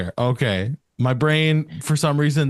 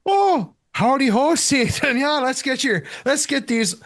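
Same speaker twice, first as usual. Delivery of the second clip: a hammed-up, higher Irish brogue, badly faked; bad Irish accent; 9 semitones higher than usual. Podcast host voicing a medieval Irishman shoeing Satan, bad Irish accent. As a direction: play it as a cheery, clumsy Irish blacksmith in a bad Irish accent. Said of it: "That's a really bad Irish accent".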